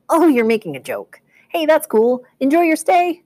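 high, upbeat salesman bellhop; overeager bellhop. Acted. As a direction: sell it like a too-happy bellhop. Bellhop laughs off the question.

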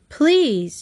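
high, pleading single word; begging. A narrator's reading. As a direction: beg them to stop, pitched high. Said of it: "Trixie begged".

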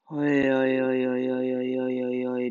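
lightly mumbled hoi, hoi, face in paws. Acted. Mickel despairing into his paws, a mild mumble. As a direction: mumble it into your hands. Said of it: "nuschelt Mickel".

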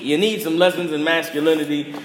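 normal talking voice

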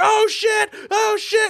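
high yelled panic; panicked shout. Retold or imitated. Hero shot at by terrorists, high panicked yell. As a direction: yelp it out high under fire. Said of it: "And he's like".

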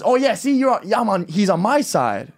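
high, cocky and sing-song; taunting high voice claiming an ally; smug gloating. Retold or imitated. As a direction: rub it in like she's won. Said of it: "she goes".

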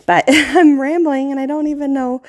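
self-deprecating laugh through the words; laughing at oneself. Real, not acted. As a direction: break into a laugh at your own rambling. Laughs at losing own track.

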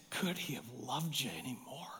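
very soft, low, near-whispered rebuke; hushed retold anger. Grieving mother answering her son's friend, hushed retold anger. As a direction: imitate anger held down to a whisper. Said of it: "she got angry".

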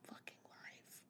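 barely voiced whisper; muttered aside. Answering people who ask about depression, whispered mutter. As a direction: whisper it under your breath.

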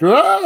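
high, loud mock yell of panic; freaked-out cry. Retelling him backing up against the map, freaked-out yell. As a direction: imitate a man crying out in alarm. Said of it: "like, freaking out".

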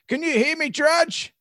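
high, panicked plea of a trapped lawyer; panicked drawl. Retold or imitated. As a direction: squeak it out in a panic. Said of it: "in a panic drawl".

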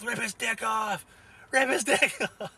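high, slow, hammed-up yell of a crazed fan; imitating a manic shout, cracking up on the second one; mock yell. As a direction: yell it like you're egging on a fight, half laughing. Retelling Rafi's fight-scene yell.

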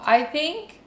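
normal talking voice

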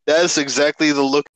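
ordinary talk

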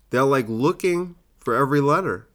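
ordinary talk, nothing marked

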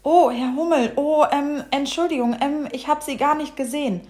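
sheepish, high storybook character; flustered apology. Acted. Dog apologises to cross bumblebee.

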